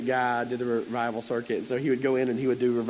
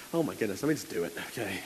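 hushed aside; 6 semitones lower, 6 dB quieter, faster than usual. Retold or imitated. Dad hushed before interlude.

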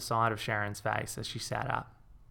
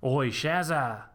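calling out; 4 semitones higher, 3 dB louder, much slower than usual. A narrator's reading. Pub calls out to her.

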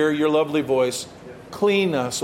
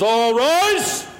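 bailiff call; 4 semitones higher than usual. Retold or imitated. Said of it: "the bailiff comes out and he says".

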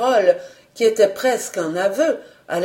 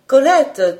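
ordinary delivery, nothing marked

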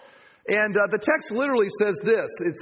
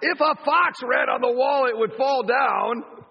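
high, loud mocking yell; jeering shout; 8 semitones higher, 3 dB louder, slower than usual. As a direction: pile on the joke loudly. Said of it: "and he goes".

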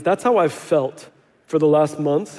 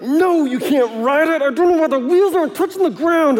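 put-on higher, exasperated no to the kids; frustrated dad; 10 semitones higher than usual. Dad telling the kids they can't ride, exasperated. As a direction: let the frustration spill out. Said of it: "I'm frustrated".

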